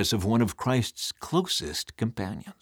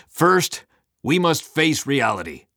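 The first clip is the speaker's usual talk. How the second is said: loud, raised, stern commander; grim Satan; 6 semitones higher, 6 dB louder than usual. A narrator's reading. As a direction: answer grimly and with force. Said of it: "Satan's voice was grim".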